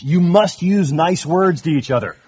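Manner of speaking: mild hammed-up parental lecture; scolding parent. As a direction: imitate yourself telling off the kids. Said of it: "heard myself ... saying to my kids".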